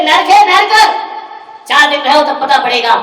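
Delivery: loud, high cry of hell; loud, bitter daughter; furious complaint. Retold or imitated. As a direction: hammer the word नरक loudly. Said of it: "बोले".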